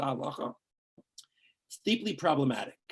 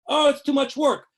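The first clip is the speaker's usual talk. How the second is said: mocking whiner; 8 semitones higher, 6 dB louder than usual. Mock lazy objector quoted.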